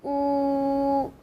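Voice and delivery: "oo" with lips pushed forward and rounded; a narrow, rounded "oo". Shown as a demonstration. Teacher showing learners how to round for the vowel. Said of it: "like the O O in the English word food".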